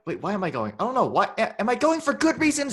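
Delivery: high, loud, spiralling panic with laughter creeping in; hammed-up indecisive fretting, climbing into a loud wail; Chidi. Co-host mocking a character's reasons for going to Sweden, panicky dithering. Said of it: "No, Chidi's like".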